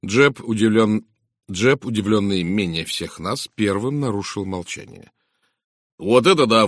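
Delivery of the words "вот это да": loud, impressed exclamation; exclaiming. A narrator's reading. Inspector reacts to Poirot's revelation, exclaiming. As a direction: burst out in loud admiration. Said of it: "воскликнул он".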